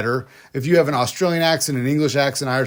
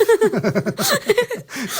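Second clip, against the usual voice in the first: high laugh. Real, not acted. Laughing at own punchline.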